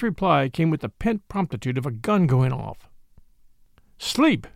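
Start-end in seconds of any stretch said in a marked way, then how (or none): 4.0-4.5 crying out. A narrator's reading. Priest answers his friend's question instantly, crying out. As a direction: exclaim it on a high, falling note. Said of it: "cried Father Brown".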